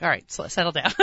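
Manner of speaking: lightly voiced soothing rabbi; half-hearted therapist calm-down. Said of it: "He's like".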